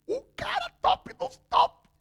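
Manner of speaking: high, crying exclamation; emotional outcry. Acted. Preacher marvelling at the humbled general to the congregation, high and crying. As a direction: cry out in amazement.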